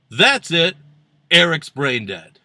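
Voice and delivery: loud, parodying pronouncement; mock outburst. Host parodying Eric's response, loud mock outburst. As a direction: fire it back as loud mock outrage. Parodies the quote just voiced.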